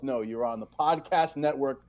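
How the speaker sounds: ordinary talk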